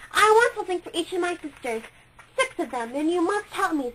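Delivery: put-on a bright, excited child in a toy shop; Maya's voice. A narrator's reading. Maya's lines in the story.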